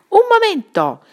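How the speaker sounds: high, loud call from a window; shout. A narrator's reading. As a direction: call out sharply to stop them. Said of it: "gridò il duca Bruttino dalla finestra". (grido forte e acuto)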